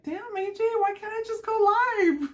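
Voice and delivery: mock-whine like a kid begging to go live; high put-on kid whine; whiny son. Son retold whining to go live.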